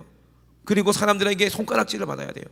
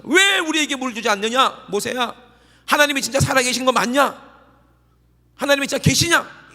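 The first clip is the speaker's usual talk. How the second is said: high, loud accusing cry of the thirsty crowd; angry demand; 8 semitones higher, 8 dB louder than usual. Retold or imitated. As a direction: hurl the questions at Moses and God. Voicing Israelites testing God, quarrel. (목마른 백성이 모세에게 따지는 높고 큰 외침)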